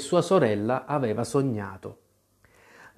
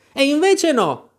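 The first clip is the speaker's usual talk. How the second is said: much higher, louder defiant retort; Alice talking back to the queen; 13 semitones higher, 5 dB louder than usual. Acted. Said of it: "replicò Alice".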